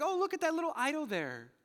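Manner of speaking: high mocking sing-song, talking down; mock the idol worshippers like a smug older brother; patronizing mock. Preacher mocking idol worshippers, high patronizing sing-song. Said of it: "He really is that kind of patronizing".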